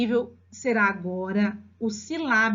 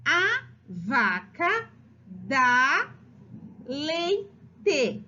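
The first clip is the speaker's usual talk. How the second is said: very slow A VACA DÁ LEITE, long gaps between pieces; syllable by syllable; 3 semitones higher, much slower than usual. Shown as a demonstration. Teacher reading out the child's sentence, syllable by syllable.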